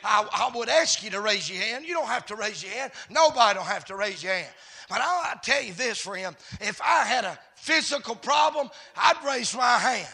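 loud, driving preacher's cadence; full-force preaching. Real, not acted. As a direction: preach it in punched, shouted phrases.

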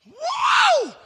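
mock rapture over the food; delighted whoa. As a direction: react to the best steak ever. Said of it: "you said".

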